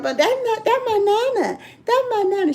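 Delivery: put-on high little-kid voice, excited; toddler voice. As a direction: play the small granddaughter pointing at nana. Grandbaby recognizes her voice.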